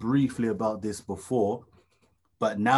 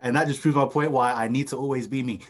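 usual speaking voice

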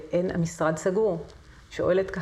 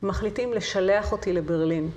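normal talking voice

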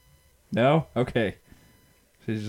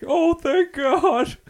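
high, slow, relieved shriek; much higher, drawn out with relief; 5 dB louder, much slower than usual. Pretend. Relieved dad on talk show.

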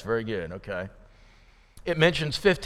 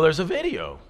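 usual speaking voice

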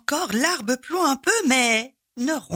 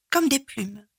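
usual speaking voice

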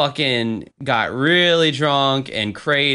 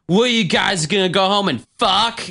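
higher, slurry mock drunk bar-goer; drunk woman imitation; 7 semitones higher than usual. Drunk Kimmy to two bandmates at a club, mocking imitation. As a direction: imitate a drunk acquaintance blurting it out. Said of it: "and Kimmy's like ... being really drunk".

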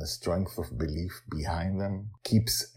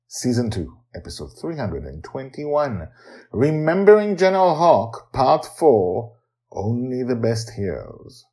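episode title read; 5 semitones higher, 7 dB louder than usual. Acted.

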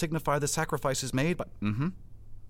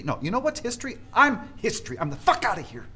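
higher, building to a louder furious declaration; rising anger; 6 semitones higher, 3 dB louder than usual. Acted. Boss storming off after his speech gets gutted, rising anger. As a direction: let the fury climb to I'm history.

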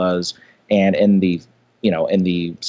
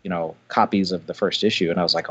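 ordinary delivery

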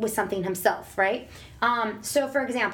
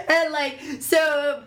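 laughing through the words; breaking into real laughter; 5 semitones higher, much slower than usual. Real, not acted. Mom laughing after telling the Target story.

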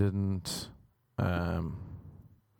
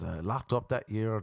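ordinary talk

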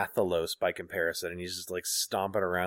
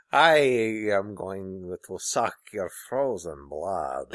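slow, menacing Russian vampire drawl; lightly voiced Russian vampire, slowly; heavy Russian accent menace; slower than usual. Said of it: "Is she like a weird Dracula".

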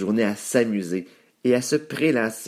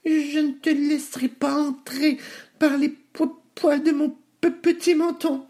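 very high squeaky piglet, stammering; piglet refusing. A narrator's reading. Little pig refusing the wolf at the door, high and stammering. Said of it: "dit sèchement le cochon".

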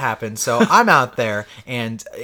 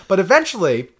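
ordinary delivery

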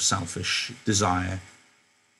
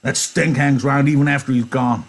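loud and disgusted, higher pitched; loud disgusted Carlson; 5 semitones higher, 5 dB louder than usual. Acted. Carlson ordering Candy to remove the stinking dog, loud. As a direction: shove the complaint at him.

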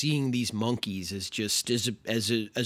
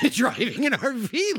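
laughing; 10 semitones higher than usual. Real, not acted. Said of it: "laughing repeat".

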